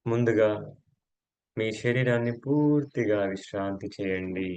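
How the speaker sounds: slow, measured relaxation voice with long pauses; guided yoga nidra. Acted. Yoga teacher guides friends to relax each body part, slow guided meditation. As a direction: let each instruction stretch out and settle.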